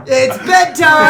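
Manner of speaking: high slow shout. Real, not acted. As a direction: yell it out, drawn out. Shouted joke.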